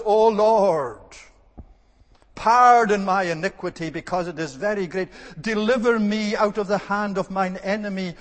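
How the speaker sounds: preacher praying the Psalms aloud; fervent psalm prayer. Acted. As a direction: plead with the Lord like a psalmist. Many of the Psalms.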